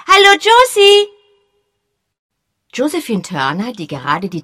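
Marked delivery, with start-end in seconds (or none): 0.1-1.1 put-on loud, high boyish call. A narrator's reading. Boy Peter calling to Josie across the terrace, loud high call. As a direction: shout a greeting across the terrace.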